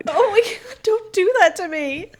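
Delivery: high, loud exclamation of relief and protest; real dismay. Real, not acted. Listener scolding host for teasing about the dog, high dismayed protest. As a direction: protest loudly, voice shooting up high.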